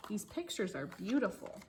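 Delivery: ordinary delivery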